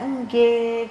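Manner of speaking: lightly lilting imitation of a gentle child; sinden-like politeness. Teacher mimics child's polite Javanese, sinden-like lilt. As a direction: draw out a polite, singer-like yes. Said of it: "kalau ngomong tuh kayak orang sindenan".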